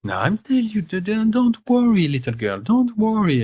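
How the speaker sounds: put-on calm the child down kindly; old healer comforting the weeping niece; reassuring old woman. Gerda reassures Vicky.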